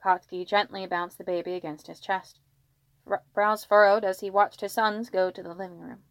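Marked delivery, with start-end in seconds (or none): none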